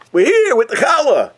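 high, loud cheerful call; excited arrival. Acted. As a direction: announce yourselves at the door. Family announces arrival.

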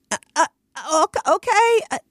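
high, strained stammer of dismay, close to crying; flustered dismay. Retold or imitated. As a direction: stammer out a reluctant okay, then a small no. Retold dismay at husband.

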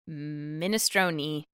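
drawn-out pun. Shown as a demonstration. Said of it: "Anita M".

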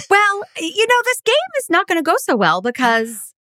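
startled gasp then worried rush; a real gasp of dismay at the failing game. Real, not acted. Gasps, dismayed game quiz host.

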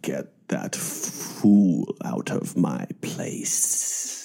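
slow, whispery sinister villain hiss; sinister lisp. Retold or imitated. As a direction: drag out every S, slow and sinister. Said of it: "he really dragged out the S's ... a sinister lisp".

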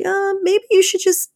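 high-pitched, tentative suggestion; Holy Spirit. Acted. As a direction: voice a polite little nudge, pitched well up. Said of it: "the Holy Spirit's like".

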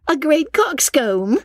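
very high, slow, rising echo; Miss Steele's echoing question. A narrator's reading. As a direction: squeal it back as a drawn-out question. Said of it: "repeated Miss Steele".

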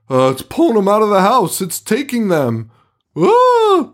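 hammed-up terrified cop yelling; panicked cop. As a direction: mimic a cop freaking out. Cop retold screaming on camera.

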